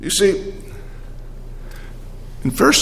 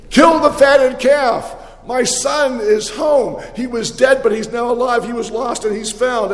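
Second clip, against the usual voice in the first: joyful proclamation; 9 semitones higher than usual. Acted. Father proclaims son's return.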